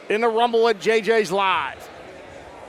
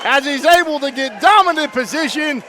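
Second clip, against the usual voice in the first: high, loud excited finish call; wrestling commentator; 5 semitones higher, 5 dB louder than usual. Real, not acted. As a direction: call the submission at full volume.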